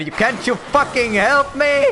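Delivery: slow, high, loud demand; real angry plea, drawn out; furious shout. Real, not acted. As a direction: bellow at him to finally help. Yelling at companion for help.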